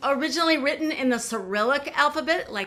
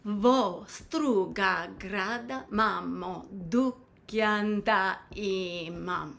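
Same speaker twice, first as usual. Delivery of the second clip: slow, drawn-out syllables in song rhythm; spoken in rhythm; 3 semitones lower, 5 dB quieter than usual. Shown as a demonstration. Teacher saying lyrics for learners to repeat, rhythmic speech. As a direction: speak the lyric slowly to the beat, unsung. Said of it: "I'll say it in the rhythm of the song".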